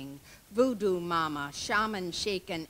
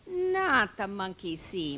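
usual speaking voice

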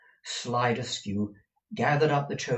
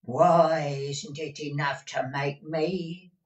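put-on aggrieved old housekeeper; 6 semitones higher, 4 dB louder than usual. A narrator's reading. Deaf Peg rejoining to her master, aggrieved. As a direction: answer back indignantly.

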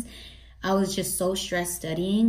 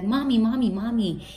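put-on higher, louder child's call for mommy; a hint of a whiny toddler calling; daughter calling mommy; 4 semitones higher, 3 dB louder than usual. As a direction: call out like a needy little kid.

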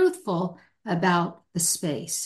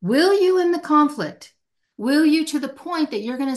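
challenging; 8 semitones higher, 4 dB louder than usual. Real, not acted. Challenging the listener.

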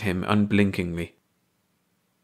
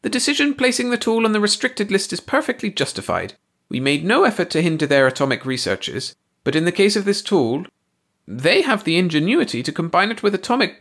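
ranting alien voice; 11 semitones higher than usual. A narrator's reading. Ursi keeps ranting.

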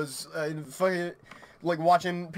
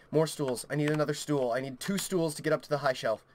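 usual speaking voice

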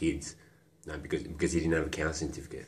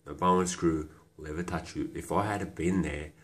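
ordinary talk

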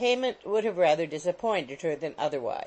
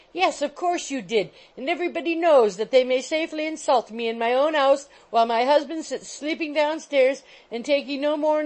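very high, loud wailing complaint; loudest pitch; 8 semitones higher, 5 dB louder than usual. A narrator's reading. Landlady shouting so her husband in the kitchen hears. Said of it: "raising her voice to its loudest pitch".

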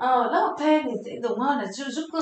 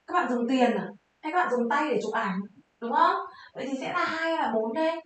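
ordinary talk, nothing marked

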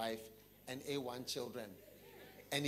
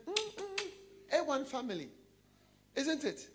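smug family man; 10 semitones higher than usual. Acted. Banker admiring his family.

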